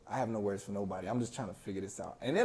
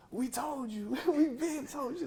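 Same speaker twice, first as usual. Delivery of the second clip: much higher mocking sing-song; friends teasing; 13 semitones higher than usual. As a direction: rub it in like gloating friends. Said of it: "they're just grilling me".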